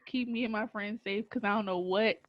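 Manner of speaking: normal talking voice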